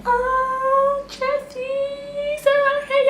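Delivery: very high mock squeal; squealing coworkers. Coworkers squealing thanks to Chelsea for donuts, very high. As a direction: shriek it like delighted coworkers.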